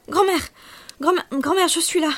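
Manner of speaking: high, loud desperate call; urgent cry. A narrator's reading. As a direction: call out to her, frantic with hope. Wakes, calls out to grandmother. (appel affolé, aigu et fort)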